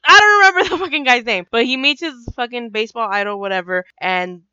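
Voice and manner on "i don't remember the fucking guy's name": loud, high-pitched exasperated outburst; frustrated, raised voice. Real, not acted. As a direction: give up on remembering, loudly. Gives up recalling a forgotten name.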